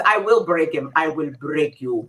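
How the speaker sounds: low, slow Russian accent, deadpan. Shown as a demonstration. Host performs the boxer line in a Russian accent. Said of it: "my Russian accent, very good".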